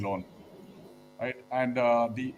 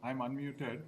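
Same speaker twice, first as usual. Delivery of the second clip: very faint, far from the mic; hardly heard over the call; barely audible; 18 dB quieter than usual. Real, not acted. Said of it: "Your voice is very low".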